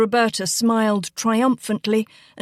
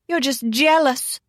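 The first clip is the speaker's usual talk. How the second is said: higher, taunting accusation; accusing; 5 semitones higher than usual. A narrator's reading. Girl following her cousin upstairs, accusing. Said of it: "Roberta followed her to accuse".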